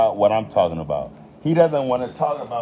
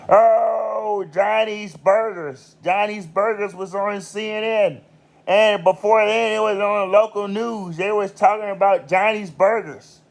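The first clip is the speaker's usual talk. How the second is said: higher, gushing proud owner voice; proud restaurant owner; 9 semitones higher than usual. Retold or imitated. Restaurant owner bragging to the viewer about his news spot, gushing higher. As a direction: play the bragging owner whose place made the news.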